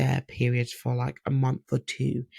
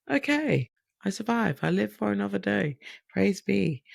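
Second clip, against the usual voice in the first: squeaky high, mock-grateful relief; 7 semitones higher than usual. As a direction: sing out that you survived.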